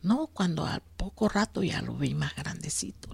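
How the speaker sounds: soft whispered recollection. Real, not acted. Telling how the ship came closer, whispered. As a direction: let it slip out in a whisper.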